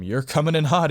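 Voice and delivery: loud, high casual call; calling after. Acted. Roommate to friend charging past, calling out.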